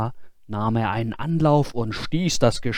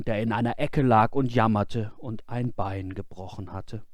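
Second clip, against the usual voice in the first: ordinary delivery, nothing marked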